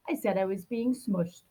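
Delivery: ordinary delivery, nothing marked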